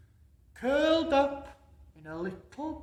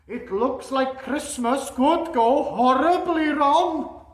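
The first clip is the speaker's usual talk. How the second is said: louder, higher, alarmed narration; building storybook drama; dramatic storytelling; 6 semitones higher, 12 dB louder than usual. A narrator's reading.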